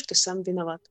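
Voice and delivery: ordinary talk, nothing marked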